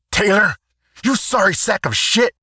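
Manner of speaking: high, loud angry shout; furious yell. Acted. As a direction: bellow it at him in a panic. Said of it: "yelled the sergeant".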